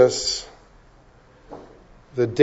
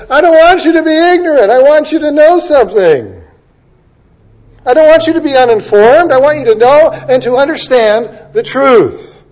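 much higher and louder, fast and insistent; full-force preaching; 9 semitones higher, 5 dB louder than usual. Acted. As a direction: hammer Paul's words home to the congregation. Paul quoted at full force.

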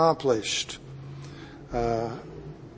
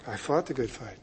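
much softer, lower aside; hushed reflection; 4 semitones lower, 13 dB quieter than usual. Acted. Preacher as Paul looking back on his life, hushed. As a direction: drop right down, as if remembering.

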